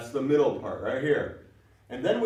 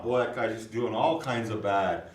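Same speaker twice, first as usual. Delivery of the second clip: ordinary delivery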